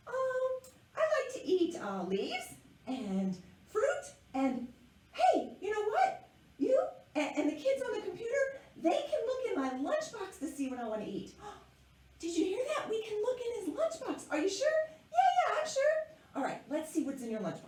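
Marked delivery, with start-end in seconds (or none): 0.1-11.3 much higher, eager little caterpillar; high puppet caterpillar voice. Acted. Caterpillar puppet keeps talking. 11.8-14.7 gasping, higher and a bit faster; excited gasp. Acted. Host reacts to puppet's offer. 15.1-15.8 much higher and louder, eager bug; high puppet caterpillar voice. Acted.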